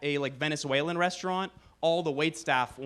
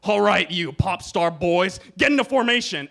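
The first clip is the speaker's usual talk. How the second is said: mock drill sergeant; 3 semitones higher, 7 dB louder than usual. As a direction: bark it at the recruits like a sergeant. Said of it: "the drill sergeant's gonna be like".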